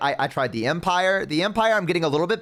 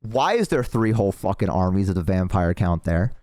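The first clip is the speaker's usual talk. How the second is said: usual speaking voice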